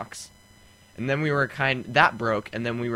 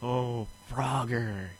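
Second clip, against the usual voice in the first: nostalgic whisper; 7 dB quieter, slower than usual. Real, not acted. Nostalgic hushed reverie.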